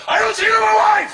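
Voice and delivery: high, loud public shout; shouted declaration. Retold or imitated. Telling a flirting woman off in front of everyone, shouted. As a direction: announce it to the whole room. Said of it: "look her in the face ... and go".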